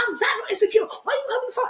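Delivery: high squeaky imitation of a woman snapping back; shrill mimicked defensive retort; mock shouty reply. Retold reality show argument.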